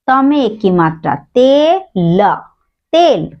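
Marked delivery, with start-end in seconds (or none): none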